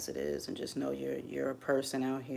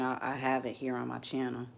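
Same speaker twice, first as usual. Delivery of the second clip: ordinary talk, nothing marked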